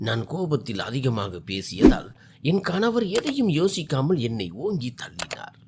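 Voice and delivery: usual speaking voice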